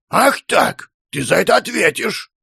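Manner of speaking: high, indignant warning; threatening. A narrator's reading. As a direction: threaten your wife, thoroughly provoked. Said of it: "промолвил он".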